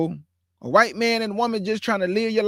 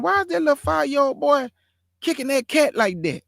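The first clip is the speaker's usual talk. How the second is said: usual speaking voice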